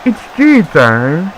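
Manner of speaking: high, drawn-out mock Englishman accent. Shown as a demonstration. Commentator playing the Englishman at tea time, high mock-English accent. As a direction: sing out a caricature English accent, slowly. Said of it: "The Englishman, because".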